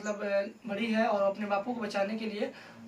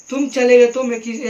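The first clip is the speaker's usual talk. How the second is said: louder howling sob; mock wailing, louder; 11 dB louder than usual. Shown as a demonstration. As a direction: howl out the loss. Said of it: "third में धाड़ में रो रहा हूँ".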